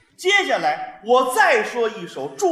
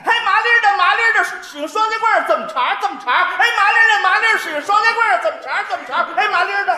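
loud high Beijing-dialect chant with heavy er; Beijing accent; 9 semitones higher, 7 dB louder, faster than usual. Pretend. Parodies the song as a Beijing lad, loud and high. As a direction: belt it like a Beijing lad, rolling the 儿. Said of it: "您来，来，来".

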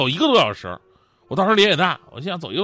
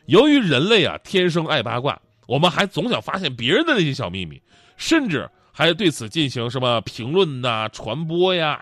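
usual speaking voice

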